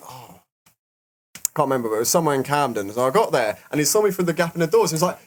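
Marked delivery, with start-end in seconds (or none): none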